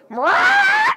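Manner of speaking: loud, high-pitched parrot screech; sudden piercing squawk, imitating a parrot. Parrot interrupting a man's sad story, loud squawk. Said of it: "the parrot goes".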